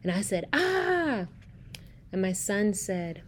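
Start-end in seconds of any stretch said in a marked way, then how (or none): 0.5-1.3 high-pitched mock dinosaur cry; retold dinosaur noise, pitched up. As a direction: make a playful dinosaur "ah". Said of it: "make a dinosaur noise".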